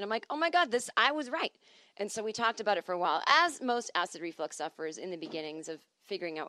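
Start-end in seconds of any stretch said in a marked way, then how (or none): 0.3-1.5 triumphant. Retold or imitated. Her diagnosis proven right.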